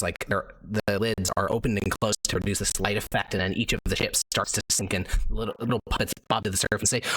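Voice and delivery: ordinary talk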